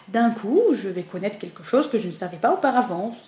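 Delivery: ordinary delivery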